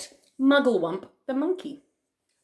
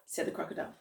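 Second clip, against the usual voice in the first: usual speaking voice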